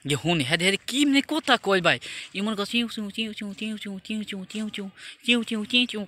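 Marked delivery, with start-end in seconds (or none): 2.4-6.0 higher, hushed squeaky chiu chiu run; mock chirping. Mocking the lovers' secret talk behind the house, squeaky chirp. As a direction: mimic a couple's tiny whispered chirps. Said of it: "করে কথা কয়".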